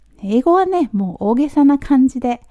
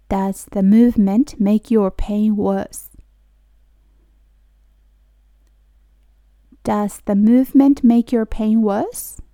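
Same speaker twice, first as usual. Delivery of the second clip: slow, careful teacher pronunciation; slow model reading; slower than usual. Shown as a demonstration. Teacher reading slowly for students to repeat. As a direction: spell each word out slowly for learners. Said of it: "私と一緒に発音してもらったら".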